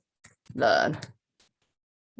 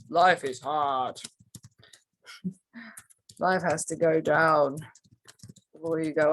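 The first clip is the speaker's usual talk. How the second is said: put-on weary old paradigm voice; 3 semitones lower than usual. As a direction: sigh it out like a defeated voice. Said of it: "Might hear from an old paradigm".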